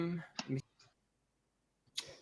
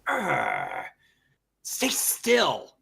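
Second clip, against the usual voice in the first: exasperated growl leaping into a higher cry; irritated growl; 3 semitones higher, much slower than usual. Acted. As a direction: growl it out in mock combat frustration. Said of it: "She kinda growls".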